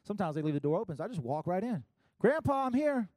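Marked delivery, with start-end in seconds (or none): 2.2-3.1 louder, higher call into the house. Retold or imitated. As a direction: call out to grandpa from the door. Calling out entering grandparents' house.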